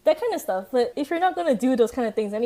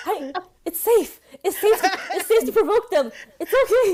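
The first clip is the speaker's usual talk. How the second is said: high, giggling it's safe; laughing mock reassurance; 8 semitones higher than usual. Friend mocking the ghost show's logic, high and laughing. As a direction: laugh through the fake reassurance. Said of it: "so I'm like".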